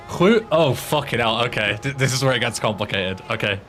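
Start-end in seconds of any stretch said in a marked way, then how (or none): none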